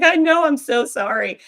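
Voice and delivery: high, loud, drawn-out mock apology; sympathetic doctor. Doctor apologizing to patient for a repeat biopsy, high commiserating wail.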